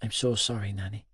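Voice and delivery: soft, remorseful murmur; low-volume, fading apology; hushed apology. Acted. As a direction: apologise quietly to your great-grandmother. Grandson apologises to tearful Nanny.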